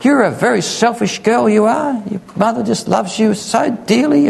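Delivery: high, scolding family voice; reproachful relatives. Retold or imitated. Relatives scold the girl for hurting her mother, high and reproachful. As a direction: wag a finger at the selfish girl. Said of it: "The family, conferences, say".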